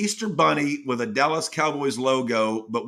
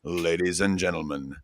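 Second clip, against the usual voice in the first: grand entrance announcement; 6 semitones lower than usual. Acted. Ring announcer build-up, quiet.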